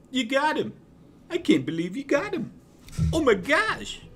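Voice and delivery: very high, loud amazed squeal; excited mouse. Acted. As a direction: squeal in disbelief at the win. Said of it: "Georgie and Ralphie come over".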